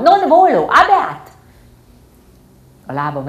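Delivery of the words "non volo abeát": high, loud shrieked Latin; shrieking. Acted. Girl shrieking at her father in Latin. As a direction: scream the refusal.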